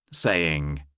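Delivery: ordinary delivery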